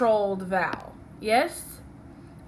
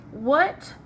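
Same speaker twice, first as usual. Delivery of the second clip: ordinary delivery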